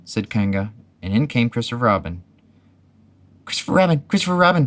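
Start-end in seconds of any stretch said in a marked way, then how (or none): 3.4-4.7 high, urgent call for help; crying out. A narrator's reading. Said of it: "cried Piglet".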